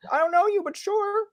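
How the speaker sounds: very high, hammed-up politeness; agreeable Canadian. Canadian accepting a stranger's invitation, mock agreeable.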